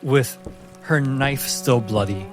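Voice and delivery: ordinary talk, nothing marked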